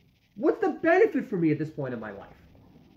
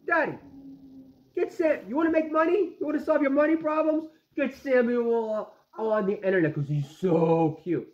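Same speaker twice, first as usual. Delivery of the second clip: higher-pitched mock kid voice; child pitching an idea, imitated by dad; imitating his daughter; 5 semitones higher than usual. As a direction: pitch it up like a pleading daughter, slowing at the end. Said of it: "My daughter, she goes".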